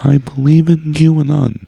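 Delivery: high, loud, slow computer-voice impression; Stephen Hawking. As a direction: imitate a speech synthesizer, halting between words. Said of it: "your guys' Stephen Hawking".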